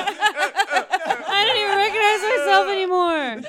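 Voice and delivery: high, slow mock lament of a robot; hammed-up horror at one's own reflection; anguished robot. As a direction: wail it slowly and high, playing along. Voices robot seeing itself in mirror.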